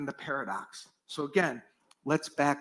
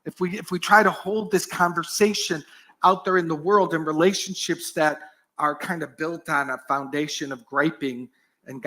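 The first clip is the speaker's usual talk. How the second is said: ordinary delivery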